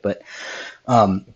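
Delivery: ordinary talk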